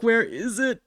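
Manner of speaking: ordinary delivery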